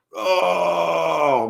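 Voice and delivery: high pained groan; pained cry. Real, not acted. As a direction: cry out from aching muscles. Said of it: "I'm so sore".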